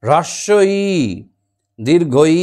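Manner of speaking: হ্রস্বই said crisply with the r cluster, দীর্ঘই said separately and clearly; careful pronunciation. Shown as a demonstration. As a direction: spell out হ্রস্বই দীর্ঘই precisely for pupils. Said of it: "উচ্চারণটা দেখো". (স্পষ্ট উচ্চারণে হ্রস্বই দীর্ঘই)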